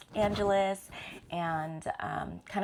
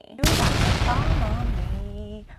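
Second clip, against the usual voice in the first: slow, loud and dramatic, every word leaned on; dramatic emphasis; 4 semitones higher, 9 dB louder, slower than usual. Acted. Telling viewers about her husband's news, slow dramatic emphasis. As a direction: make it land like a bombshell.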